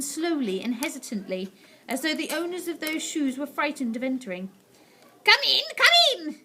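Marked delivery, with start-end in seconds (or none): 5.2-6.4 much higher, louder snap; witch snapping. A narrator's reading. Witch snapping at hesitant witches. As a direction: snap impatiently. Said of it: "snapped the Grand High Witch".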